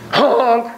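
high, punchy horn honk; imitated car honk. Shown as a demonstration. Driver honking at someone not moving, imitated honk.

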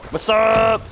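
high, loud, drawn-out taunt; loud gloating call after a kill; taunting what's up. Real, not acted. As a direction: crow it at the victim.

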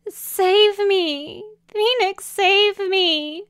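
high, loud tearful plea; desperate crying. Acted. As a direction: beg to be rescued, wailing. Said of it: "tears streaming down his cheeks".

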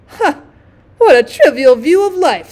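scornful. Acted. Rocket dismisses the fire balloon's view, scornful. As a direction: scoff grandly at a silly idea.

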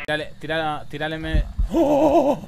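loud high frantic screaming; yelling in terror; panicking gamer. Real, not acted. Ghost hunting, players screaming.

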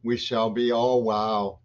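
normal talking voice

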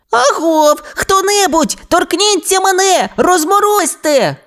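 very high, pleading dinosaur voice; calling for help. A narrator's reading. Frozen toy dinosaur begs to be released, high plaintive call. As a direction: plead high and helpless, stretching the last word. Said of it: "кликав досі заморожений Рекс".

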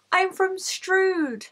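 put-on very high, drawn-out child's reply; mock your small self, stretching each word up high; imitated little kid. Six-year-old self telling the trainer her town, high and drawn out. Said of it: "so I was really little".

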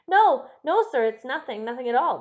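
loud, high burst of denial; urgent interruption. A narrator's reading. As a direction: break in loudly, desperate to deny it. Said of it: "the butler broke in".

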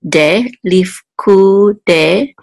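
leaf ku de spelled out syllable by syllable, slowly; de, leaf ku de, slowly, each syllable separate; slow sounding-out. Shown as a demonstration. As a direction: drag out leaf ku de like a reading teacher. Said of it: "will say de".

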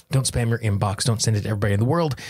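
ordinary talk

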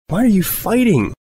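high, teary, wavering question; on the verge of crying; sniffling. Acted. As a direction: hold back tears. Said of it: "she sniffed".

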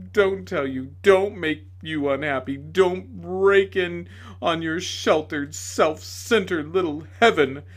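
loud, slow, sneering accusation; bitter tirade. A narrator's reading. Tragedian accusing the lady, loud sneering tirade. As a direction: hurl every don't back at her.

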